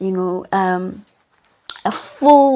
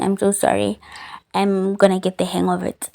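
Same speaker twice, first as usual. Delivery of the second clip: ordinary talk, nothing marked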